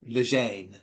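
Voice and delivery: "Lejeune" said as luh-ZHAYN; alternative name pronunciation. Shown as a demonstration. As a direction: try "Lejeune" low, rhyming with Jane. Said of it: "could be".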